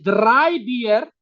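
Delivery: "drei Bier" with rolled Spanish r's; R vibrante. Shown as a demonstration. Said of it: "tres cervezas con la R". ("drei Bier" con erres vibrantes)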